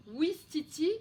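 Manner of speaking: "ouistiti" projected with every syllable crisp; sounded-out example word. Shown as a demonstration. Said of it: "il y a le son ou".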